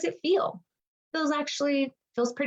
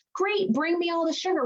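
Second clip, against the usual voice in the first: mock greedy glee; 9 semitones higher than usual. Mocking the sugar-binge mindset.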